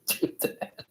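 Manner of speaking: giggling through the words; laughing at own burp. Real, not acted. Laughing after belching on stream.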